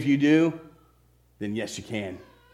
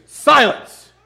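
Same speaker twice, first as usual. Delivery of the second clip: higher, much louder command to the wind; commanding rebuke; 11 semitones higher, 9 dB louder than usual. A narrator's reading. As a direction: shout down the storm. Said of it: "he rebuked".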